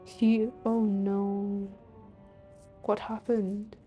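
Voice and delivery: ordinary delivery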